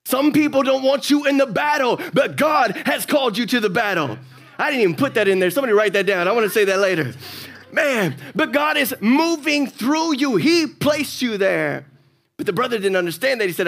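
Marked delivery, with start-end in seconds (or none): 0.0-11.9 loud, high preaching climb; full-force sermon peak over music; fiery preaching. Acted. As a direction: preach it out to the room.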